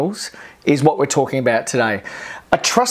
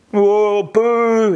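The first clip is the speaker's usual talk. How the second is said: mock booing; 5 semitones higher than usual. Members booing the wave.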